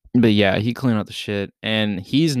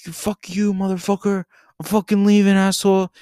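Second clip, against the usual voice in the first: high strained cursing, building louder; mock angry send-off; 7 semitones higher, 4 dB louder than usual. Storyteller acts out ditching drunk friend on lawn, mock anger. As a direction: storm off in put-on fury.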